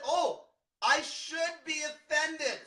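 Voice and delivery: mock the fake outrage; mock offended. Coach mocks people who only think they should be offended, sneering.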